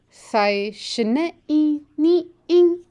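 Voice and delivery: "Thai shne i ni ing" said slowly, each syllable spaced, pitch lifting on the tones; slow model sentence. Shown as a demonstration. Teacher models a Shoshone sentence for the class, slow and careful. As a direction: model it slowly for learners to copy. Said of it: "so repeat the sentence after me now".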